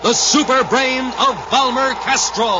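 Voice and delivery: high, slow, ringing title call; announced title. Acted. Announcer calling out the episode title, slow and high. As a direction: stretch out the episode title grandly.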